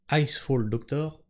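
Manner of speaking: slow, deliberate English accent on "Icefall Doctor"; "Icefall" opened with an English "eye" sound, slowly. Shown as a demonstration. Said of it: "notez l'accent anglais".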